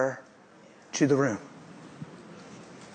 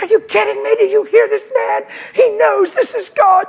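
put-on the shaken girl crying out; frightened girl shouting; 4 dB louder than usual. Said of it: "She's like".

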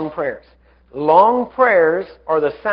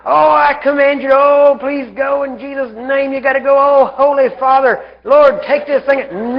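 drawn-out, high, loud begging; overdone beseeching prayer; mock pleading prayer; 6 semitones higher, 4 dB louder, slower than usual. Shown as a demonstration. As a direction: wail a long-winded plea to heaven, hammed up. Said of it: "None of that. That does not work".

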